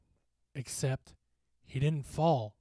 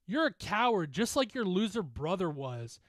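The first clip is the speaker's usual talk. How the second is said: high, raised-voice insults; shouted taunt; 7 semitones higher, 5 dB louder than usual. Acted. Bully holding a teammate in a headlock, shouting. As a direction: hurl the insults loudly at him. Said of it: "he shouted at me".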